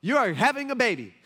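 startled doctor breaking news; doctor telling couple the baby is coming, high surprise; surprised doctor. Retold or imitated.

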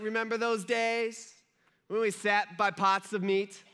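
slow, drawn-out mock whine; whiny. Israelites longing for Egypt's food, whiny. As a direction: pine whinily for the old days, stretching words. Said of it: "in a really whiny voice".

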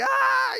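very high, loud shriek of fright; mock scream. Disciples shrieking at the naked men, loud mock shriek. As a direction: shriek back like a startled kid. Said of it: "the disciples are like".

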